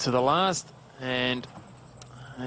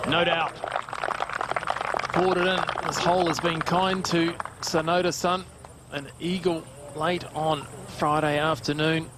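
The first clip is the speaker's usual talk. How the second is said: golf commentator; 4 semitones higher than usual. Real, not acted. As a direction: call the putt dropping with a lift. Live call of a holed putt.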